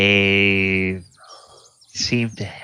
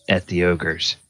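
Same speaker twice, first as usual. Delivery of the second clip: ordinary talk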